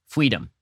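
"Freedom" with the R turned to W; a babyish "fweedom" for "Freedom"; mock toddler pronunciation. Host repeating the toddler's word, mocking lisp on "Freedom". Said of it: "it is spelled F W E E D O M".

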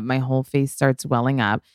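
normal talking voice

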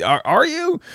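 high incredulous squeak; mock disbelief. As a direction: doubt him in a high voice. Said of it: "It's like".

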